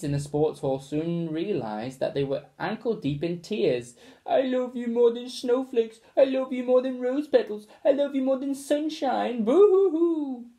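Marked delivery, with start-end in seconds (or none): 4.2-10.5 weepy gushing. A narrator's reading. Family weeps declarations at each other, very high sobbing. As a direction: gush through sobs.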